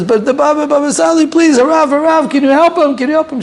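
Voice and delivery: very high, urgent begging. Retold or imitated. Mother begging the Baba Sali to help her son, very high. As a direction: plead with the rabbi, voice climbing. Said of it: "She raised her voice".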